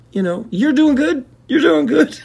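very high sing-song reassurance; mock consoling falsetto; patronizing praise. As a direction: pat them on the head. Condescending pat on the head.

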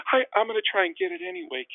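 squeaky-high voice, playing himself intimidated; high-pitched mock reply. Acts out his timid reply.

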